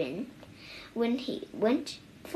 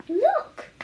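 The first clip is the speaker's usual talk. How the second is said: much higher, louder exclamation; excited cry; 5 dB louder than usual. A narrator's reading. Old mouse thrilled his pants stay up, excited cry. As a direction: call out in delight. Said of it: "cried the old mouse".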